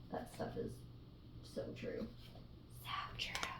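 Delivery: hushed, unvoiced whisper; whispered aside. Real, not acted. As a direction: whisper it like a private confirmation. Emphatic whispered aside to viewers.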